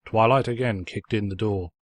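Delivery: ordinary delivery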